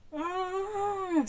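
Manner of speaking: high, open-mouthed wordless moan; struggling to speak. A narrator's reading. Scully tries answering the sheriff, struggling to form words. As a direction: try to talk with the mouth stuck open. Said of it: "struggling to fi-, form words".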